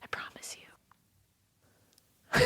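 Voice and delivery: soft breathy whisper of a promise. Acted. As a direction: hush it like a secret. Teasing secret promise.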